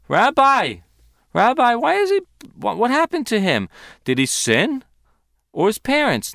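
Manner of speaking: lightly acted, high-pitched puzzled question; questioning disciples.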